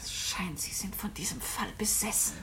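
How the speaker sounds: soft unvoiced whisper, a little teasing. Woman whispering a teasing remark to her companion. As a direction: whisper a tease close to his ear. Hushed teasing between guests.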